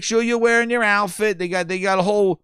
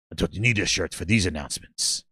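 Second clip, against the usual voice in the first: much lower, quieter mock Herman voice; imitating Herman; 12 semitones lower, 6 dB quieter than usual. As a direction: drop the voice low and quieter to play Herman. Mimicking Herman's announcement voice.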